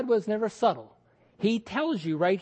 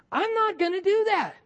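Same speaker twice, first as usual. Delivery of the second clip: defiant refusal; 11 semitones higher than usual. Retold or imitated. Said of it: "He says".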